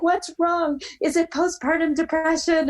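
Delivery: high-pitched alarmed questions; freaked-out mom. Retold or imitated. Mom guessing at her crying daughter's trouble, alarmed. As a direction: imitate a mother panicking down the phone. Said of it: "it totally freaked her out".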